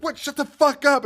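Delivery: high-pitched mock snap; hammed-up, high irritated yelp; retold exasperated outburst. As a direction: imitate a woman fed up with a prank caller. Retold woman snapping at caller.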